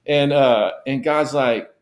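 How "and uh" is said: usual speaking voice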